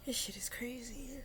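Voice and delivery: hushed breathy whisper; whispered aside. Real, not acted. Musing to herself about her own story, whispering. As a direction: drop to a whisper to yourself.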